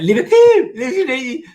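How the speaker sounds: high, hammed-up Liverpudlian accent; bad Liverpudlian accent. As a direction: attempt Scouse, squeaky and failing, half laughing. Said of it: "I can't do a Liverpudlian accent".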